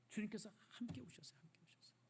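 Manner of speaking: whispered, fading to almost nothing; whispered, fading to near silence; moved whisper. Real, not acted. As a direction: let it sink into a hush. Preacher describing Jesus weeping.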